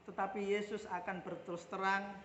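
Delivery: normal talking voice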